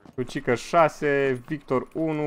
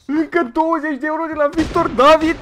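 disbelieving squeal; 4 dB louder than usual. Real, not acted. Streamer to his chat as donations keep coming, shocked squeal.

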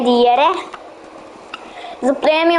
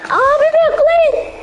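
high-pitched calling out; 11 semitones higher than usual. Real, not acted. Calling teammates to wait.

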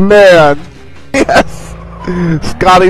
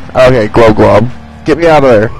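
much lower, gruff voice; deep character voice; 12 semitones lower, faster than usual. Pretend. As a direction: drop into a low cartoon voice.

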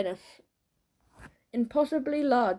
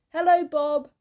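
high, loud hailing shout; narrated bully's shouted hello; 6 semitones higher, 8 dB louder than usual. A narrator's reading. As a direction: call out a greeting across the playground. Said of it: "shouted one grub".

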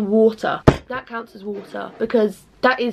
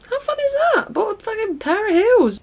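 mock excitement; 11 semitones higher than usual. Mock excited about heels.

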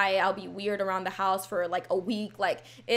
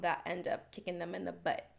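ordinary talk, nothing marked

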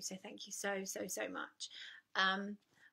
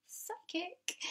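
slow, breathy joking whisper; sly whisper; 9 semitones higher, 6 dB quieter than usual. Acted. Whispering a mock confession about being psychic to viewers. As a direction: let it slip out as a secret joke. Self-mocking aside after empath claim.

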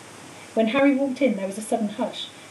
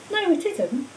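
gently thoughtful disagreement, higher-pitched; 7 semitones higher, slower than usual. A narrator's reading. Harry weighing up what Dumbledore did, thoughtful. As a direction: muse it aloud, unhurried in feeling. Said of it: "said Harry thoughtfully".

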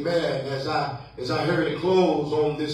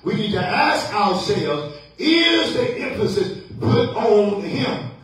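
rising pulpit cadence, punched questions; building preaching refrain; 5 semitones higher, 3 dB louder than usual. Acted. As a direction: press the challenge on the congregation.

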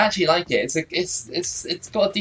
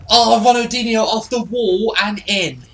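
excited shout; 8 semitones higher, 6 dB louder than usual. Real, not acted. Celebrating a goal in game.

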